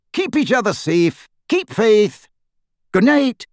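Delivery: high-pitched, measured broadcast sign-off; high, buoyant host's farewell; radio host signing off. Acted. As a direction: wrap up the show in a lifted announcer voice.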